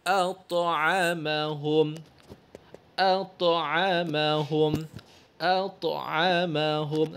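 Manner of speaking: At'amahum very slowly with a bounced ت; qalqalah on ta. Shown as a demonstration. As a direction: draw out At'amahum, letting the ت echo. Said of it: "ada qalqalah sedikit".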